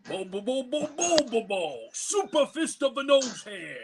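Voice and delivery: put-on anime character voice; goofy anime hero voice; Bobobo voice. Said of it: "I also do".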